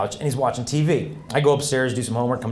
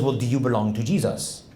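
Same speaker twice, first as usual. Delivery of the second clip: put-on French-accented question; 3 semitones lower than usual. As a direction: ask it like a French priest. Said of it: "there's a priest visiting from France".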